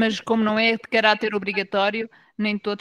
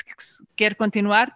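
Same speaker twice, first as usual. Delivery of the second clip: ordinary talk, nothing marked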